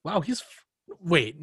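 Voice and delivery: ordinary talk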